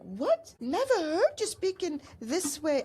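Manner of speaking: shocked. Retold or imitated. Daughter shocked by mom's unfamiliar dialect, shocked. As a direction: quote a shocked reaction, voice jumping up. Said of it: "I was shocked".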